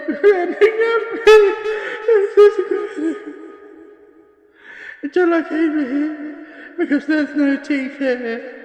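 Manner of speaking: unhinged laugh, losing her grip; manic laughter. Acted. Witness laughing madly as she describes the grinning walls.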